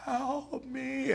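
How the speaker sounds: soft, high, stretched plea; entranced cry for help. Retold or imitated. Tyler pleading from the next bed, soft high wail. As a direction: imitate a weak plea from a trance. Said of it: "I look back at Tyler".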